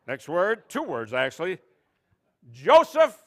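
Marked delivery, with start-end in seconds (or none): 2.5-3.2 loud, higher name call; loud announcer. Pretend. Host announcing the word, loud.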